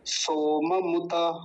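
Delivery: सोममुत stretched, full मु; very slow demo; slow careful pronunciation. Shown as a demonstration. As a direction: draw out सोममुत slowly. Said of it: "ये शब्द बड़ा महत्वपूर्ण है".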